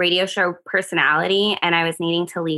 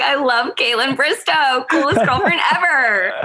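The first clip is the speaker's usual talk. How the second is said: mock gushing; 7 semitones higher than usual. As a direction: gush teasingly through a laugh. Guest mimics host's delighted reaction.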